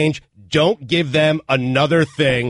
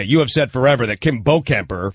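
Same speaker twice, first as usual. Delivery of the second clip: normal talking voice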